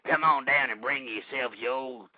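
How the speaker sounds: low, soft, put-on celebrity voice; Red Fox. Host has Red Fox pitch the junkyard, low impersonation. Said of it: "bring down Red Fox and have him say".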